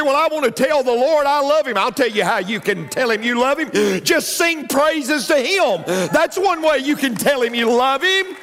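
high, loud preacher's call; fired-up sermon voice; preaching at full force. Acted. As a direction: rouse the congregation.

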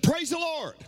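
joyful woman's shout of praise; lady calling out. Retold or imitated. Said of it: "she again said".

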